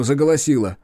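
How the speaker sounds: usual speaking voice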